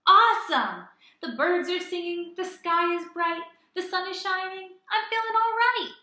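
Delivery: loud, high, joyful cheer; thrilled to see the world anew; excited squirrel. Acted. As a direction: sing out the happy chant. Squirrel delighted with sunglasses.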